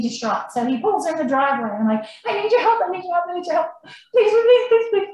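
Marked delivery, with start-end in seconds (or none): 2.3-5.1 mock panicked pleading, pitched high; frantic plea. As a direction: beg a stranger for help in a panic. Retelling frantic plea to stranger.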